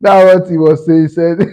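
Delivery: normal talking voice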